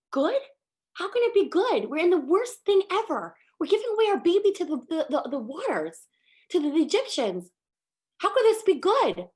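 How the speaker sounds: high, loud disbelieving cry; anguished outcry. Acted. Parents' anguished outburst at Miriam.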